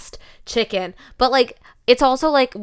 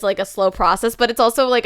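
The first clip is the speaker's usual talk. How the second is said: ordinary talk, nothing marked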